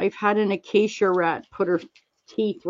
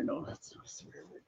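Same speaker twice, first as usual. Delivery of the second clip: whispered, under the breath; hushed self-reassuring aside; 19 dB quieter than usual. Real, not acted. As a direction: whisper it to yourself. Aside about swearing.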